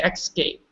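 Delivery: "escape" copied with the wrong X, EKS-kape; imitated EX-CAPE. Teacher repeats what she heard Bonnie say, wrong ex-cape. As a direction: mimic the student's escape with a k before the s. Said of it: "I heard you say escape".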